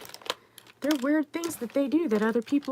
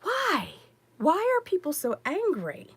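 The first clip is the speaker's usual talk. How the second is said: exasperated why; 8 semitones higher than usual. Retold or imitated. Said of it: "I'm just like".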